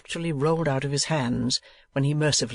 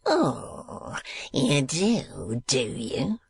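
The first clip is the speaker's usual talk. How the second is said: mocking sneer fading into a hiss; much slower than usual. A narrator's reading. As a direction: sneer it with scorn. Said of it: "sneered Squeers".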